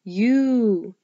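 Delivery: U held long as "yoo"; long U sound. Shown as a demonstration. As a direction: draw U out into a long, clear "yoo". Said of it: "This word has the U sound".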